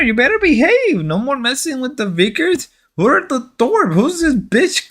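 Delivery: high, hammed-up Mexican-accented threats; mock Mexican accent. Relative threatening him with cartel cousins, mock Mexican accent. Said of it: "I gotta stop doing the Mexican voice".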